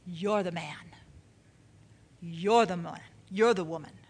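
low, quiet, pointed accusation; grave accusation. Acted. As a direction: confront them softly and low, word by word. Prophet confronts David, acted.

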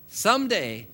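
usual speaking voice

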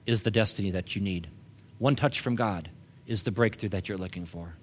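soft, low preaching voice with long pauses; gently minister to the congregation, low and soft; hushed ministry appeal. Real, not acted.

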